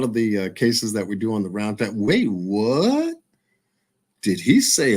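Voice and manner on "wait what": imitating. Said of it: "Fifty-four Keys would say".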